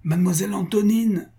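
high calling out across the garden; anxious call. A narrator's reading. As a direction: call her name anxiously from afar. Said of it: "une voix s'écria avec angoisse".

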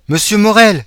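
high, loud call from the stairs. Acted. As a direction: shout up the stairwell. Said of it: "s'écria une voix dans l'escalier". (appel crié depuis l'escalier)

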